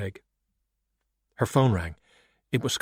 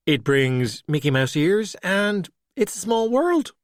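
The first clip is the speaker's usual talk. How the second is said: slow, rising much higher on "small world"; playful sing-song; 8 semitones higher, slower than usual. Acted. Valkyrie teasing Skullduggery, slow and playful, climbing high. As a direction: tease him with theme park promises.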